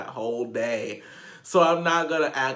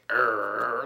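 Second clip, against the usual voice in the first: imitating a grunt; 4 semitones lower than usual. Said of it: "He's like".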